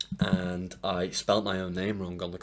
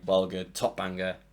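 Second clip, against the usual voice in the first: ordinary talk, nothing marked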